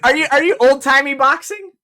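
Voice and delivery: normal talking voice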